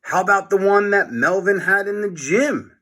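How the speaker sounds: put-on a kid with a bright idea; Harold voice. A narrator's reading. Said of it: "said Harold".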